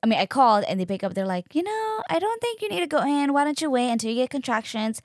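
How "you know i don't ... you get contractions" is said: higher, breezy quick dismissal; mimicking a casual clinic voice; weekend clinic answering, light and quick; clinic receptionist. Clinic staff on phone.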